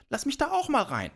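high, quick pestering voice; insistent demand. A narrator's reading. Giant's mother wants into the sack, insistent high demand. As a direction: push to be let in, high and hurried.

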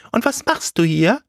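high-pitched scarecrow character. A narrator's reading. Scarecrow questions the tailor, high put-on voice. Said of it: "sagte die Vogelscheuche".

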